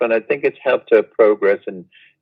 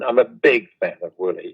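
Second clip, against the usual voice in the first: normal talking voice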